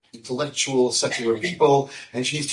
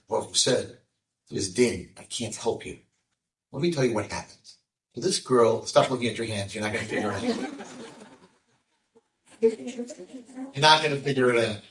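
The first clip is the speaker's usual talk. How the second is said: normal talking voice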